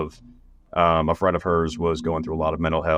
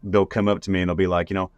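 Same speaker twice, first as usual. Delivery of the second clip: ordinary delivery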